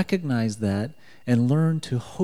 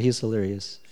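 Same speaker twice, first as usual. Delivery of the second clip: usual speaking voice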